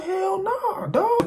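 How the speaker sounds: high, whining hell nah; shocked refusal. Retold or imitated. Narrator refusing coworker's offer, high shocked whine. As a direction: recoil in disbelief, voice jumping way up.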